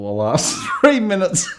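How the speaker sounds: can't hold back the giggle at the end; laughing through it. Real, not acted. Telling the men's group the story, laughing. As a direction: quote breaking into real laughter. Laughs while quoting the drunk fan.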